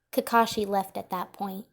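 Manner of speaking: ordinary delivery, nothing marked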